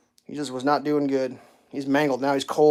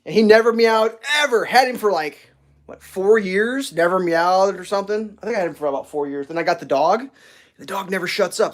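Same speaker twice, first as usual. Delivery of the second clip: usual speaking voice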